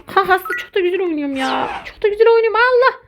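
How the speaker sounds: high, rising sarcastic whine. Real, not acted. Gamer mocking his own play, high sarcastic whine. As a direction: brag bitterly, voice climbing. Sarcastic complaint after failing.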